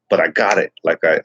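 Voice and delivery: normal talking voice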